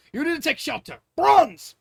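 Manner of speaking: loud, high battle command; commanding shout. Acted. King shouting orders to his soldiers, loud and high. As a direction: bark the order over the chaos.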